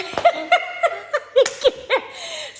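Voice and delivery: high-pitched delighted laugh; laughing at your own joke. Real, not acted. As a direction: crack up at your own song. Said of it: "I make myself laugh".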